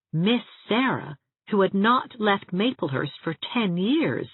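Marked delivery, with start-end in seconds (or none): none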